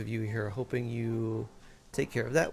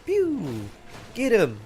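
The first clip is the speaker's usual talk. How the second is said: high loud pew then cheer; excited cheer; 7 semitones higher, 7 dB louder, slower than usual. Real, not acted. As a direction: egg on the attack. Cheering an attack.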